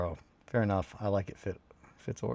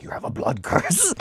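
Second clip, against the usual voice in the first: higher put-on doom-saying voice; imitating superstitious relative; 10 semitones higher, 4 dB louder than usual. Streamer quoting curse-obsessed relative to viewers, mock doom. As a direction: mimic a relative announcing doom. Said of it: "the one that is just like".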